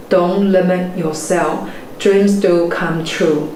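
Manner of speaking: ordinary delivery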